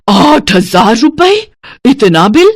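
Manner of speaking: shocked gasp, then high drawn-out disbelief; aghast, rising incredulous questions; alarmed. Acted. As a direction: react in panic to a huge bill. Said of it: "देखते ही घबरा जाती है".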